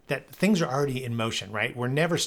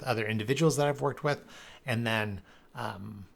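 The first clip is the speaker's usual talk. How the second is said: ordinary talk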